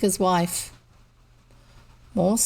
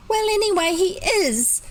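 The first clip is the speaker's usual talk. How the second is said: put-on high, firm little girl's voice; decided little girl; 12 semitones higher than usual. A narrator's reading. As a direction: settle it stubbornly. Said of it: "she ended decidedly".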